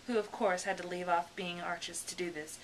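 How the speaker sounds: usual speaking voice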